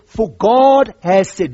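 God said slow and loud; stress on God. Shown as a demonstration. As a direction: lean slowly into God has said. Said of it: "Notice the word".

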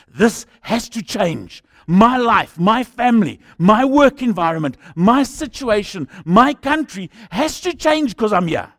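slow, loud rallying cry; declaring shout. Acted. Preacher declaring congregation's resolve.

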